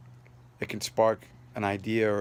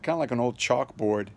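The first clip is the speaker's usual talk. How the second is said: ordinary talk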